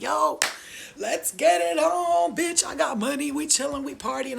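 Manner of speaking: high, loud hollering, stretched at the start; hyped party shout. Retold or imitated. Bad girl with cash in hand, hyped party holler. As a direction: whoop it up like a reckless party girl. Voices her party self with money.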